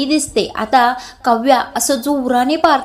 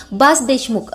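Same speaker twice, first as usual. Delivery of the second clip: plain reading voice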